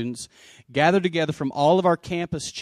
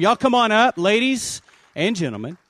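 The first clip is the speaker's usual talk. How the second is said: calling them up, higher and louder; 6 semitones higher, 5 dB louder than usual. Real, not acted. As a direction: call the group up to the stage. Pastor calls people to stage.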